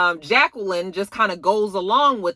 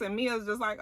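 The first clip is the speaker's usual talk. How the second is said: normal talking voice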